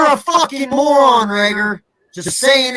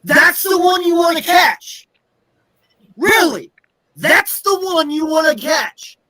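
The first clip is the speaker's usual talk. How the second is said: high, exasperated yelling; angry shout; 7 semitones higher than usual. Real, not acted. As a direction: yell it at the screen, disgusted. Fan raging at muffed punt.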